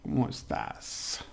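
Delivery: breathy whisper with a laugh before it; whispered ¿Cómo estás? with rising tune; whispered greeting. Shown as a demonstration. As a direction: say it under your breath, playful. Teacher first says the greeting.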